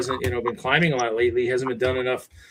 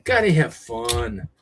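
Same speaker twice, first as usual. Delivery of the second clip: drawn-out motto; 5 dB louder, much slower than usual. Pretend.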